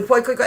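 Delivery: "poi koi koi" rattled off quickly; said too fast, garbled. Shown as a demonstration. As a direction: rush the name into "poi koi koi". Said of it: "if I say it really fast".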